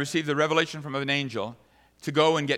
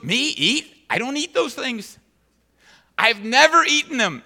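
higher indignant Peter; Peter refusing; 9 semitones higher, 7 dB louder than usual. Retold or imitated. Preacher voicing Peter refusing to eat, indignant. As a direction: refuse in disbelief. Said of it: "And he goes".

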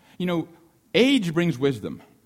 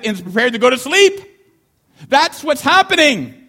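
higher and louder, building up the scene; excited telling; 8 semitones higher, 8 dB louder than usual. Acted. Storytelling build-up.

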